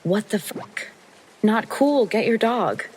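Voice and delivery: shocked retold curse at a stranger; retold angry outburst. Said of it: "I manage to utter".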